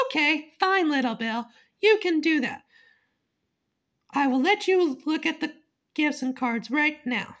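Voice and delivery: mom voice. Acted. Mom voice, higher.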